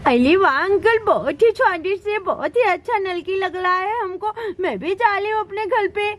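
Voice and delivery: put-on lisping childish praise; delighted little child. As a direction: gush like a small child enjoying a treat.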